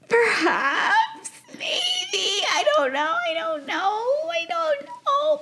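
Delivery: very high, silly singsong squeak; coy falsetto, last words stretched out; playful high squeal. Pretend. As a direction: squeak it out in mock uncertainty. Playful coy musing on looking good.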